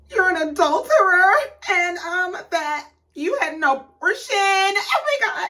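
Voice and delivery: high, halting fake-tearful retelling; hammed-up sobs between broken phrases; mock sobbing. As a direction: imitate a woman tearfully spilling her story. Imitating Mia's tearful confession to ladies.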